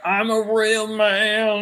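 high, drawn-out mocking voice; mock macho boast. Co-host mocking a man trying to look manly, hammed-up boast. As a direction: stretch it out in a high, put-on voice. Mocks the man's macho posturing.

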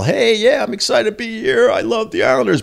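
high-pitched mock-polite fan greeting; nice and polite. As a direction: imitate a sweet, harmless interview soundbite. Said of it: "supposed to be just a nice little".

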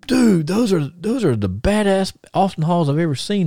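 high, awed retold exclamation; amazed praise. Magnum to the teller, admiring the boots, awed and high. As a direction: gush high over the boots.

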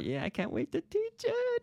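very high squeaky put-on voice; mock eagerness. Teacher joking about an upcoming lesson, squeaky mock excitement. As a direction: squeal it with hammed-up anticipation.

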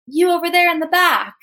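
a stage host picks out someone at the back; host calling out. Retold or imitated. Stage host Robin calls out to the audience, high and loud. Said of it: "And then he goes".

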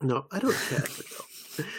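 put-on understated, hammy British accent. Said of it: "I said that in a British accent".

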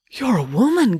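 taken aback, pitch leaping up; gasped astonishment. A narrator's reading. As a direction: blurt it out, astonished. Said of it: "gasped Jo".

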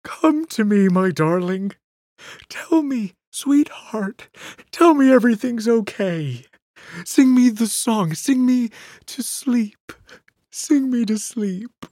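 trembling Bert. Acted. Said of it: "Bert's trembling voice".

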